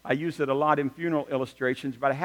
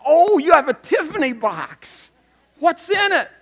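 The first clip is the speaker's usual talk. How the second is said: high, loud, drawn-out squeal of delight; hammed-up awe and burning curiosity; gushing excitement; 12 semitones higher, 9 dB louder than usual. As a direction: imitate a teacher thrilled by the box. Imitates second teacher's thrilled reaction.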